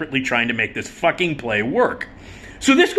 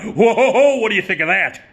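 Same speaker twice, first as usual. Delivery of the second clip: put-on high, loud hammy old vaudevillian; hammed-up showman laugh; 7 semitones higher, 5 dB louder than usual. Old comic mugging to the audience with a big laugh. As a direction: play it to the crowd, big and cheesy. Impersonating Mickey Rooney on stage.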